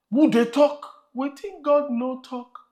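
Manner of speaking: lively Pidgin aside in preaching voice; Nigerian Pidgin. Acted. Pastor teasing the congregation in Pidgin, lively. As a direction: slip into Pidgin for the crowd. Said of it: "switches into Pidgin preaching".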